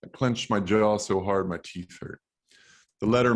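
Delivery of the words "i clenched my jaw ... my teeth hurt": usual speaking voice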